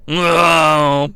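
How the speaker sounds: imitated glum groan; mock dejected moan. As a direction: sound let down, drawn out. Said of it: "he's doing a down voice".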